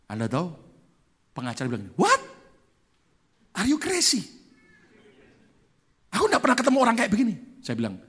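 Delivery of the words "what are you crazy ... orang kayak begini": higher, fast, disbelieving outburst; amazed lawyer. Retold or imitated. Lawyer who has never met a man like this, fast and amazed. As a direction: blurt it out in disbelief.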